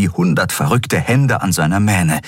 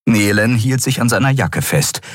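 ordinary delivery

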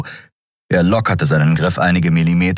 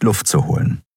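usual speaking voice

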